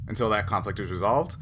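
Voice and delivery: ordinary talk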